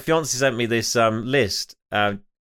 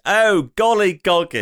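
higher, slow posh exclamation; a plummy girl gasping at her own mistake; posh student voice; 6 semitones higher, 5 dB louder, slower than usual. Retold or imitated. Retelling the posh student's slip, higher and slow. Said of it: "she sounds a little bit, um, posh".

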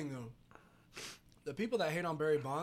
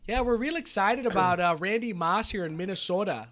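put-on higher, louder Minnesota accent; 4 semitones higher, 5 dB louder than usual. Friend mimicking excited Minnesota football fans, in a Minnesota accent. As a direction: do an eager Minnesota local. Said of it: "That's the Minnesota accent right there".

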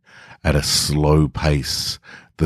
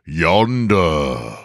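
booming wizard. A narrator's reading. Grand Wizard demanding the stranger's name, booming. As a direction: boom it slowly from the throne. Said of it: "The Grand Wizard repeated himself".

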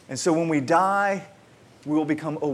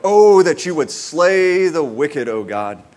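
loud psalm plea; 8 dB louder, slower than usual. Retold or imitated.

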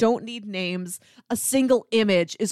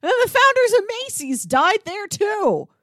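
mock Bill Paxton; 11 semitones higher than usual. Bill Paxton's spiel voice, mock telling the Macy's founders. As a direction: ham up a documentary narrator. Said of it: "He's not like".

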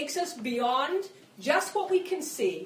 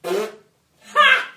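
loud burst of laughter; 2 semitones lower, 14 dB louder than usual. Real, not acted. Laughs at hard life.

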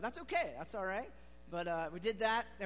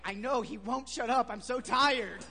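annoyed grumble; 3 semitones higher, 10 dB louder than usual. Retold or imitated. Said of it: "And I was like".

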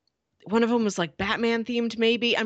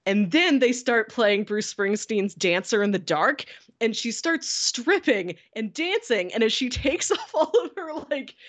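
ordinary talk

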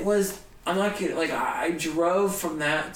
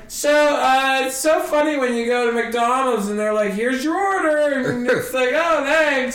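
higher, louder hammed-up stage patter; bombing comic; 7 semitones higher, 5 dB louder than usual. Comic bombing while tickets are sold during his set, straining. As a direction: push a weak bit as a flailing comedian would. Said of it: "I'm bombing horribly".

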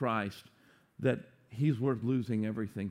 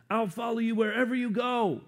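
eager volunteer; 9 semitones higher, 4 dB louder than usual. Acted. Eager follower volunteering to Jesus.

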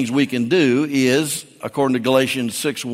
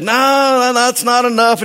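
high, mock-indignant refusal of the offer; store owner haggling; 8 semitones higher than usual. Shop owner turning down the dealer's offer, mock indignant and high. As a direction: play the shopkeeper holding out for more. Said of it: "the hardware store said".

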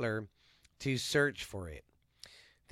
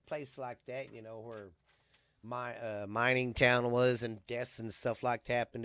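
ordinary talk

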